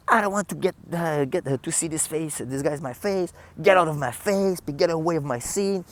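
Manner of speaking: high, huffy mock tantrum; drama queen tantrum. Electrician yelling at the artist's manager, mock tantrum. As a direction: play him as a hysterical drama queen. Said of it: "il me fait une crise de drama queen".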